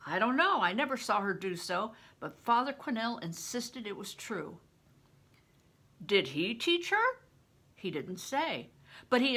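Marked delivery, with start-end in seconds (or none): none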